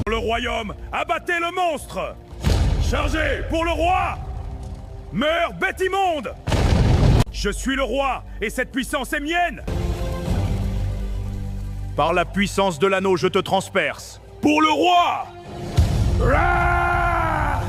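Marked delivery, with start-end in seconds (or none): 0.1-6.3 battle shout. Acted. King charges the monster. 16.2-17.6 battle roar. Acted. Battle cry.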